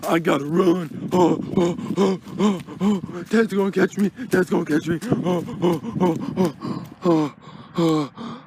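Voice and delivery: loud, high panicked cries while fleeing; scared kid running for his life. Acted. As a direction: yelp it out like you're being chased.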